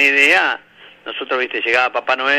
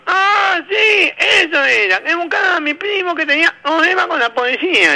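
put-on very high, slow nasal-sounding boy; nasal kid voice; 3 dB louder than usual. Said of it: "el nene gangoso".

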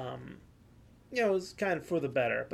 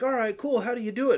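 higher, louder eager newcomer; eager newbie; 8 semitones higher, 7 dB louder, faster than usual. Pretend. Friend acting a curious newcomer asking about weed, high and eager. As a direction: play the keen beginner asking how.

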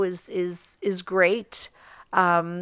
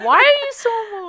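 much higher, louder and fast teasing; 8 dB louder, much faster than usual. Real, not acted. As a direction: playfully needle her for crying. Teasing co-host about crying.

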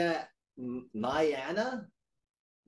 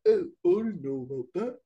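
a light mock Liverpool accent, slightly raised; 4 semitones higher, 4 dB louder than usual. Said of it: "the way [name] would talk".

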